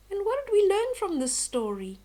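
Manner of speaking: bright question. Real, not acted. Teacher asks moral question.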